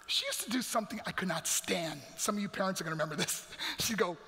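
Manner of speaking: ordinary talk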